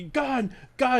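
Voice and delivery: high, alarmed mock shout of gun; panicked cop yelling. Cop to the unarmed man, panicked mock yell. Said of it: "And the".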